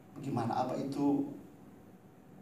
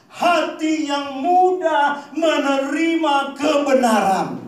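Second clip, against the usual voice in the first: loud, slow, higher pulpit voice; louder preacher cadence, words drawn out; full-force preaching; 8 semitones higher, 11 dB louder than usual. Acted. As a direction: drive each phrase home to the congregation.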